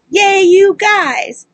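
high, drawn-out happy cheer; excited cheer. Real, not acted. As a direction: cheer them on, stretching it out. Cheering on fellow podcast.

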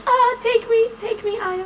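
melodramatic swoon, voice way up; hammed-up damsel begging to be bitten; swooning heroine. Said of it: "she's all there like".

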